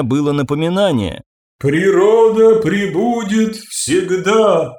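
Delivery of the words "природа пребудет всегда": Природа пребудет всегда, slow, high, ringing and imperious; ringing, commanding. Acted. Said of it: "сказала звонко и властно".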